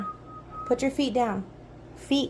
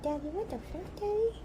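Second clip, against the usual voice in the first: higher pitched, rising on the second Daddy; dog's voice pitched up; 8 semitones higher, 8 dB quieter than usual. Pretend. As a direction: squeak it up like the puppy. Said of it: "She's like".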